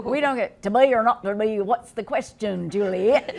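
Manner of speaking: mock the snooty school staff in a put-on British voice.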